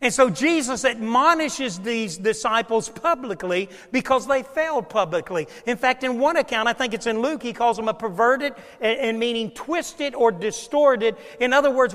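normal talking voice

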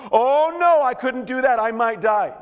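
hammed-up fearful protest; mock panic. Scared rider refusing the bucket, mock panic.

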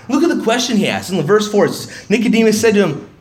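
ordinary delivery

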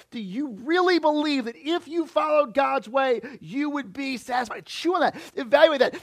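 loud, driving preacher voice; impassioned sermon delivery; full-force preaching. Acted. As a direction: push it out to the whole room.

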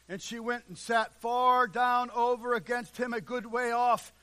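loud, high, rolling preacher's cry; fiery sermon delivery, voice ringing; full-force preaching. Acted. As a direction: thunder it out from the pulpit.

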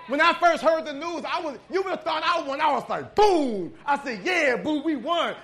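loud, high burst of excitement; excited. Retold or imitated. Preacher retelling the news, loud and excited. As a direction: celebrate the win out loud.

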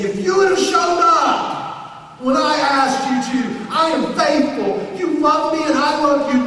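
jab a finger and yell the blame; angry shout. Acted. Believer shouting blame at God, finger in his face. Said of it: "stick your finger in the Son of God's face and say".

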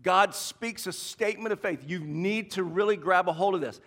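usual speaking voice

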